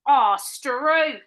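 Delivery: loud hammy Aussie exclamation; Australian accent. Acted. Co-host playing at being Australian, loud Australian accent. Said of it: "what people from Australia say".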